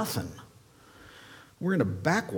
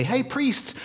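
calling out; 12 semitones higher than usual. Acted. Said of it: "he goes on ... to say".

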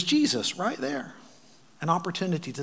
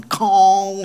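bell clang imitation; 6 semitones higher, 11 dB louder than usual. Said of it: "not just going".

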